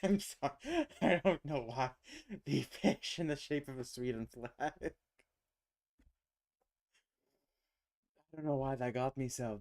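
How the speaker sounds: words spilling out between laughs; laughing. Real, not acted. Cracking up at the fish shaped like a flag: real laughter. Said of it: "oh, that was amusing".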